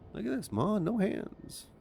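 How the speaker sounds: high, put-on kid's boast; mock showing-off child. Torpedo showing off to Ma, mock childish boast.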